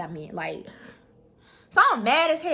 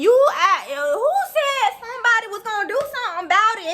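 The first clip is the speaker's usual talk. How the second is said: mock confrontation; 8 semitones higher, 4 dB louder than usual. Confronting the girl on bus.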